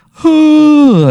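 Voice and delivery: high, loud waking stretch sound; a big morning stretch noise, way up high; stretching yawn. Acted. Player waking from a dream, stretching with a high yawn.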